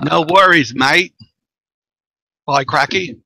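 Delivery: imitation Australian accent; Aussie accent. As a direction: have a playful go at an Aussie greeting. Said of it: "That's a brilliant Aussie accent you got going on there".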